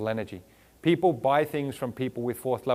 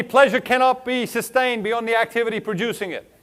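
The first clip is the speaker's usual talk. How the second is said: higher and louder, drilling the line; call to repeat; 7 semitones higher, 6 dB louder than usual. Shown as a demonstration. Speaker to audience, loud call-and-repeat line. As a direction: belt it out so the room repeats it. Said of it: "Repeat after me".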